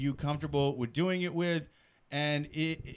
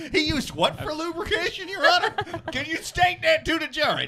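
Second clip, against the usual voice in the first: high, incredulous lawyer questioning; courtroom lawyer; 8 semitones higher, 3 dB louder than usual. Pretend. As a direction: grill the witness in disbelief.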